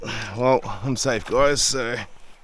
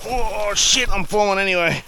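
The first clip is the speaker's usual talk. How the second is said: sudden high alarmed cry while slipping; startled shout as he slides; alarmed yelp; 11 semitones higher, 5 dB louder than usual. Real, not acted. Slipping down steep bank.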